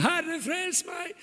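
Peter's cry for help. Acted. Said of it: "Da ropte han".

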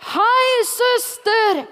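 high, loud greeting "Hei, søster!" across the park; calling out from afar. Retold or imitated. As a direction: shout it cheerfully to someone far away. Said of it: "på avstand så roper han bare".

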